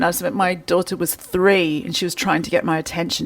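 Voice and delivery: ordinary delivery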